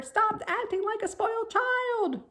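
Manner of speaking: very high, loud scream. A narrator's reading. Wilbur screaming at Templeton, loud high. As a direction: scream at the lazy rat. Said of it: "screamed Wilbur".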